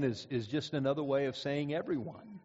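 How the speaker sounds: ordinary delivery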